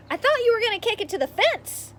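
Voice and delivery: yelling. Acted. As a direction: call it out mockingly across the field. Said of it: "Connor yells".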